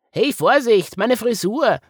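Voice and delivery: high, loud squeaky objection; fussy little cartoon character; protesting bunny. A narrator's reading. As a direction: yelp in mock indignation as a small animal. Hugged bunny protests about hair.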